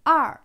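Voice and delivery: "Er" as "ar" with no French R; Mandarin er, no R. Shown as a demonstration. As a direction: curl the tongue on "Er", falling tone. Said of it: "Il n'y a pas de R à la fin".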